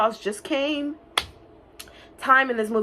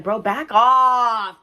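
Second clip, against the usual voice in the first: mock tough warning; 3 semitones higher, 5 dB louder, much slower than usual. Voicing the Toad.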